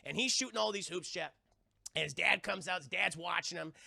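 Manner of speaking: ordinary talk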